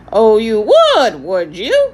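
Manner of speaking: loud, slow parrot voice, sarcasm gone, coaxing; ingratiating parrot. A narrator's reading. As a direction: sweet-talk them like a fawning parrot. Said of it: "his tone has lost its sarcasm and was quite ingratiating".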